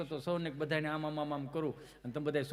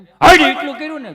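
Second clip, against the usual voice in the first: much higher, very loud yell; shout; 10 semitones higher, 23 dB louder than usual. Retold or imitated. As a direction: blast it out at the dog. Auntie shouts at the dog.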